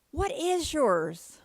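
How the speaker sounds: slow, drawn-out question; doubtful mother. Retold or imitated. Mother looks up from the papers and asks, slowly and pointedly. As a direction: stretch each word out as you ask.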